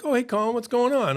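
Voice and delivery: high, sing-song mock-friendly hello; feigned casual greeting. Playing along as he greets the cop, high feigned friendliness. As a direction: greet her in a high, put-on chummy voice.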